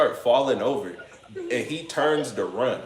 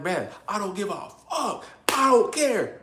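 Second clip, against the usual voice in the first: mock defiant yell; 8 semitones higher than usual. Him refusing to leave the fight, high defiant yell. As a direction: play himself furious and past caring. Retelling his defiant reply.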